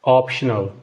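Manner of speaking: ऑप्शनल said the English way as "optional"; English example. Shown as a demonstration. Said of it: "अब इसी तरह है". (ऑप्शनल अंग्रेज़ी उच्चारण में)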